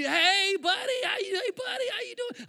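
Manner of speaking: very high, hearty hello; imitating an over-friendly pastor; gushing pastor greeting. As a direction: call out as if delighted to see him. Pastor greets him, retold.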